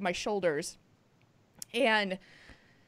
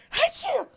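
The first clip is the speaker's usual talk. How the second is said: imitated sneeze. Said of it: "she goes".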